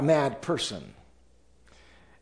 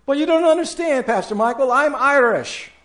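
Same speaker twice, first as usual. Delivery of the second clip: much higher, louder, fast protesting whine; whiny excuse; 10 semitones higher, 5 dB louder, faster than usual. Acted. Congregant protesting to the pastor, high whiny voice. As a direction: squeal the excuse like an offended churchgoer.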